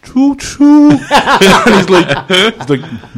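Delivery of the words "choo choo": high, loud choo-choo; desperate train noise, pitched way up; imitated train whistle. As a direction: hoot it like a toy train. Tourist imitating a train for Frenchman.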